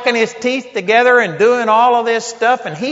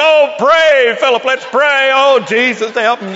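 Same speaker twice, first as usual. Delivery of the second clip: higher and louder, a hammed-up frightened plea; mock panicked prayer; 5 semitones higher, 4 dB louder than usual. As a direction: parody a scared believer clutching a friend. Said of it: "grabbed Philip's hand".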